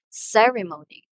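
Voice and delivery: "ceremony" as CE-re-mo-ny, front punched; strong first syllable in "ceremony", falling away; stress on first syllable. Shown as a demonstration. Teacher repeats the word for learners, "ceremony" stressed on its first syllable. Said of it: "first syllable receives the emphasis".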